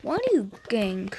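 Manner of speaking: normal talking voice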